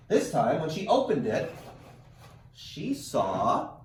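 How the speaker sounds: lively read-aloud storytelling; animated picture-book narration; reading to children. A narrator's reading. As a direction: read it like story time for kids. Teacher reads picture book aloud.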